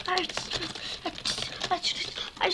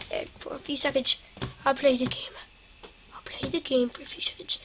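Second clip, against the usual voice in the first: hushed whisper to herself; 3 semitones lower, 9 dB quieter, faster than usual. Real, not acted. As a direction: whisper it under your breath. Whispered aside while hurrying.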